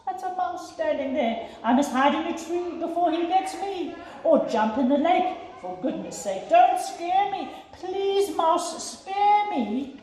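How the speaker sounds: high, pleading, scared elephant voice; frightened elephant. Acted. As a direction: beg the mouse not to scare you. Elephant panics at the mouse.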